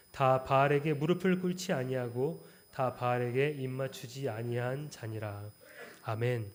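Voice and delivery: loud, measured Bible reading; scripture reading. Acted. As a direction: proclaim the verses to the congregation. Reads scripture aloud in sermon.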